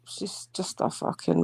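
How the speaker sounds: hushed whispered insult; whispering. Real, not acted. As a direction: whisper it aside. Muttered aside to friends.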